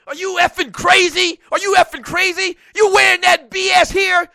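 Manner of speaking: loud, high jeering yell; hostile fan shout. Retold or imitated. Philly fan yelling at visiting Falcons fans, loud jeering shout. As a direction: bellow it across the stands at the outsiders. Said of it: "somebody says something".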